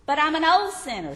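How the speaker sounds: high, loud pleading objection; an anxious sinner pleading loudly. Acted.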